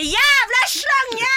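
furious yelled insult, high and loud; angry shout. Retold or imitated. Storming away from the row, shouting an insult at her. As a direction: scream it at her as you storm off.

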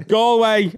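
Galway as gɔlweɪ, open aw; corrected Galway. Shown as a demonstration. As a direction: try the Irish Galway. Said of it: "learn how to say it right".

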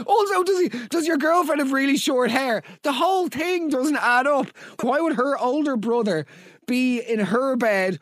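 high, incredulous comic questioning; exasperated disbelief. Real, not acted. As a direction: pick the story apart in a high, baffled voice.

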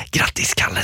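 a sly, unvoiced whisper. Friend whispering a birthday greeting at the door, sly whisper. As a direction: breathe it mischievously, no voice at all. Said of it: "Du viskar till mig så här".

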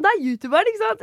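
lightly voiced starstruck guy; excited stranger. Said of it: "han var sånn".

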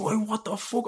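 retold outrage. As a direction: relive the stunned protest. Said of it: "I was snapping".

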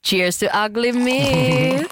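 singsong toast with a held last word; mock toast. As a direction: stretch it out like a playful slogan. Mock toast as segment jingle.

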